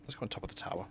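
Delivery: hushed whisper to himself. Real, not acted. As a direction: breathe the words out, barely voiced. Quiet aside while playing.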